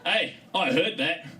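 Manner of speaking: offended tough guy snapping back; hammed-up irritated superhero; gruff Wolverine kelp. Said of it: "sounds like Wolverine".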